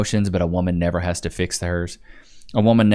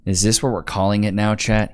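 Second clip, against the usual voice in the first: ordinary talk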